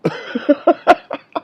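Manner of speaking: high laugh at his own joke. Real, not acted. Speaker after his own theology joke, laughing. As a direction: burst into high, real laughter.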